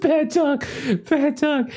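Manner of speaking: very high, strained falsetto with gaps; hammed-up squeaky dying voice; wounded man scolding. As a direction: play a dying man feebly scolding a dog. Dying gunman scolds the dog.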